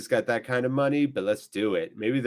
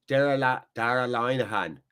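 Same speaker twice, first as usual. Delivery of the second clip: put-on trying out the name in an Irish accent; amateur Irish lilt on the player's name. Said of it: "trying to work on my Irish accent".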